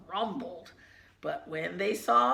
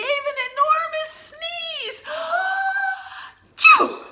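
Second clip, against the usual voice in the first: building sneeze; 10 dB louder than usual. A narrator's reading. Reading to a child, acting out the bear's sneeze, building to a loud shout. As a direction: pitch climbing higher and louder into a huge sneeze.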